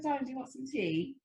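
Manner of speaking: put-on high-pitched playful British accent. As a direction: ask like a British darling, softly. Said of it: "I do a great British accent".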